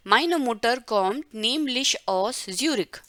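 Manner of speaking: very slow, word by word; slow model reading. Shown as a demonstration. Teacher reading Elena's German sentence slowly. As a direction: read the German line slowly and evenly. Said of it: "model reading of text".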